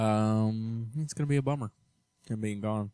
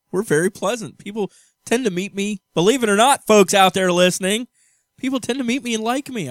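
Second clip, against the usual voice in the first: louder and higher, protesting to the folks listening; 9 semitones higher, 7 dB louder than usual. Real, not acted. Husband loudly assures listeners they're pleasant. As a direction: insist they're pleasant, raising the voice.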